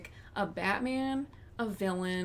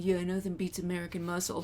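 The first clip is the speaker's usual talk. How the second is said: Vin Diesel voice; 2 semitones lower, 3 dB quieter than usual. Retold or imitated. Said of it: "Vin Diesel in my head saying".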